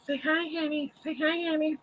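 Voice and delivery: high sweet pet talk. Real, not acted. Coaxing the dog to greet.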